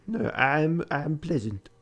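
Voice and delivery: lightly put-on French accent, pleased with himself. Narrator's polite French accent, lightly voiced. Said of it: "I know the accent wasn't great".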